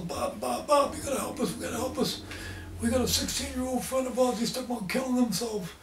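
put-on high, breathless pleading girls' voices; begging for help in a panic; frantic girls.

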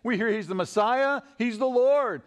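high, mounting excitement; calling out. Acted. Blind men seeing their one shot, calling out excitedly. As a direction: build phrase by phrase to a loud peak. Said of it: "they're calling out".

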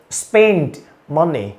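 slow "spend money" with the d fully sounded; drawn-out, careful "spend money"; clearly released d. Shown as a demonstration. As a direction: separate the words, popping the d in "spend". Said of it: "Âm đờ bật ra rất rõ".

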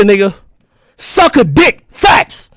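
ordinary talk

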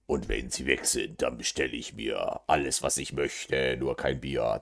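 very low, soft, even delivery; low confiding mumble. Acted. Plotting an order once she is gone, low and soft. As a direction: drop right down, as if keeping it secret.

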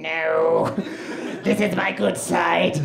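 mock vain man's drawn-out refusal; photo subject refusing. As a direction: object slowly in a put-on voice. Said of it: "this guy's just gone".

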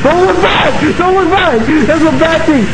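high, urgent cry of warning; real alarm, voice jumping up high; panicked warning. Real, not acted. Gamer warning teammate not to look back, panicked. Fleeing a monster in game.

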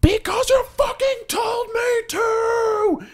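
high, loud, slow furious yell; full-throated angry scream, way up high. Retold or imitated. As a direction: scream it at her through the car window. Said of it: "and I screamed".

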